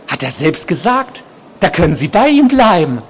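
high-pitched urging voice; obsessed patient, insistent. A narrator's reading. Patient urging nurse to stay with doctor, high insistent voice. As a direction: urge her on in a high voice.

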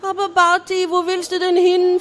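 high, loud pleading king; worried king. A narrator's reading. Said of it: "fragte der König". (hohe, laute Königsstimme, fragend)

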